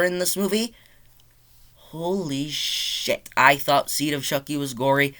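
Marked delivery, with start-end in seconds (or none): 1.8-3.3 very slow, softer, stretched-out expletive; drawn-out amazement. Real, not acted. Reviewer reacting to the gore, slow stretched amazement. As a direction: stretch each word out in awe.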